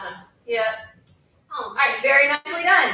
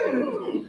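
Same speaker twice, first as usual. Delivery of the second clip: high hum sliding down; 7 semitones higher, 5 dB quieter than usual. Shown as a demonstration. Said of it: "you need to go down".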